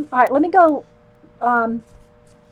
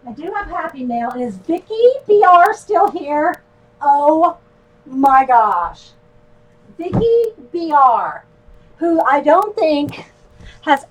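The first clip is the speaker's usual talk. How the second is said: louder, higher, thrilled delivery; real excitement; 3 semitones higher, 6 dB louder than usual. Real, not acted. Vlogger tells viewers Vicky has sent mail, excited. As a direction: stretch the words in amazed delight.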